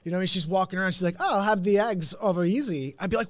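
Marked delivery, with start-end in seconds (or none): 1.2-3.0 louder, put-on Walken impression; Christopher Walken. Imagined girlfriend ordering eggs, as Christopher Walken. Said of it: "she starts doing Christopher Walken".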